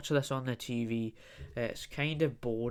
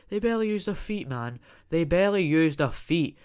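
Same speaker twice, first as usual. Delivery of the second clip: ranting; 5 semitones higher, 6 dB louder than usual. Acted. Ranting outburst against football.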